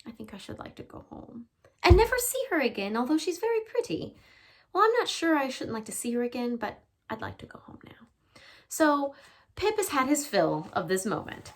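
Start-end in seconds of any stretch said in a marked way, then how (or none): 0.0-6.6 put-on upset boy. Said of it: "He says". 6.6-8.4 unvoiced, small whisper; tired boy whispering; whispered plea. Boy's quiet plea.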